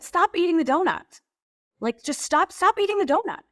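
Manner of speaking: high, sharp retold order; imitating a husband barking stop; husband snapping. Wife imitates husband ordering her to stop eating, mock snap. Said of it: "He's like".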